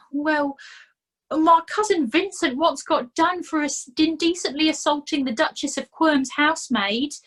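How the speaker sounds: self-pitying complaint; whining Nobby. A narrator's reading. Nobby complaining to Fred about his bad luck, whining.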